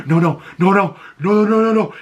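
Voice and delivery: rising panic with each repeat; panicked no no no. Retold or imitated. Vlogger voicing dread as Vigilante creeps up, rising panicked repeats. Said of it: "It's almost like".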